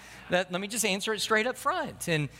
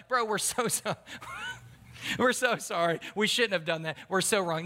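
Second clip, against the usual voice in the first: laughing apology; 3 semitones higher than usual. Acted. Buddies apologising to the friend they dropped, laughing. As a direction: imitate buddies cracking up while saying sorry. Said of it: "Nothing says you're sorry like laughing".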